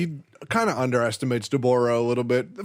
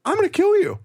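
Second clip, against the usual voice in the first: high, louder cheery menace; high sing-song threat; 4 dB louder, much faster than usual. Retold or imitated. Fighter threatening Hercule over the money, high and cheery. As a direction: threaten him sweetly.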